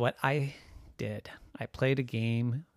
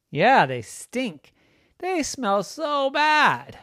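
slow, loud, grossed-out; slow disgusted groan; 12 semitones higher, 6 dB louder, much slower than usual. Acted. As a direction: drag out the stink. Disgusted description.

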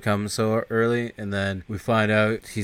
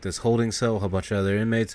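usual speaking voice

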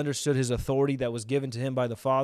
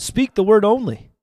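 raised, emphatic preaching call; loud exhortation; 7 semitones higher, 7 dB louder than usual. Real, not acted. Preacher urges viewers to say it aloud, loud exhortation. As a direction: call it out high and loud for them to repeat. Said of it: "say that out loud".

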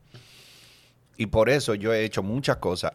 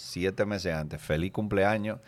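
very slow, dry repeat; slow deadpan; 4 dB quieter, slower than usual. Shown as a demonstration. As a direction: drag out each word flatly. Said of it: "slow deadpan repeat".

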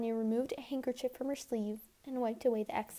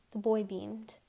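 usual speaking voice

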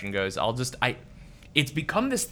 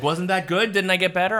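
imitating wife; 9 semitones higher, 4 dB louder than usual. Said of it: "she'll slap me and be like".

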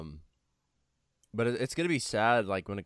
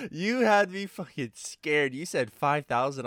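relieved laugh; 6 semitones higher, 5 dB louder than usual. Real, not acted.

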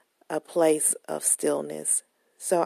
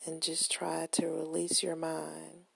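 soft whispered meditation line; whispering; 7 dB quieter than usual. Acted. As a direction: whisper it gently, no voice.

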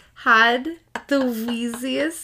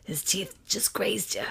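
whisper; 4 semitones lower, 5 dB quieter than usual. Acted. Gina soothing injured Kyle.

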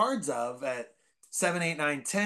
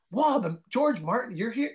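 starstruck greeting; 8 semitones higher than usual. Retold or imitated.

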